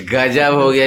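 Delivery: real laugh of disbelief, voice dropping a little; amused laughter. Real, not acted. Reporter hearing of absent candidate, chuckling in amazement. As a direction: chuckle into the words.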